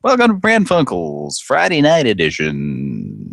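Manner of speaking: high, drawn-out mock announcer intro; over-the-top high-pitched host welcome; show announcer. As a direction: stretch out the show title like a hammy announcer.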